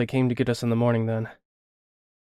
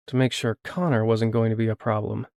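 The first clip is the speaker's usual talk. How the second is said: ordinary delivery, nothing marked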